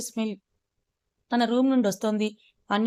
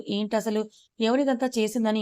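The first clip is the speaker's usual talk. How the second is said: usual speaking voice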